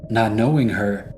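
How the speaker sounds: usual speaking voice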